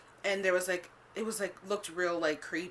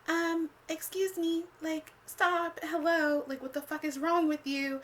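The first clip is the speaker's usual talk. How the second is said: mock polite rebuff; 9 semitones higher, slower than usual. Fending off his groping hands.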